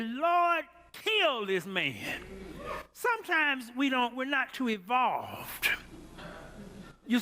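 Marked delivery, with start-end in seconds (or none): none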